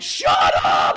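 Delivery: loud mock shout of disbelief. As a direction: yell it in embarrassed disbelief. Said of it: "I'm like".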